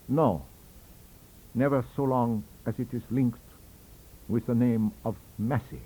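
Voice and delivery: plain reading voice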